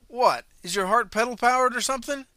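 loud, high sarcastic jab; mocking snort. Rod sneers at the panicked runner, sarcastic. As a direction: sneer at him in a different voice. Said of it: "Rod snorted".

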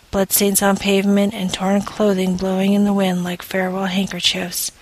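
usual speaking voice

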